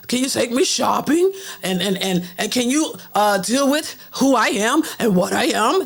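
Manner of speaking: mock her begging a man to take her shopping; mocking old woman voice. Mocking LisaRaye asking a man to accept her, croaky. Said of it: "That's how she talking".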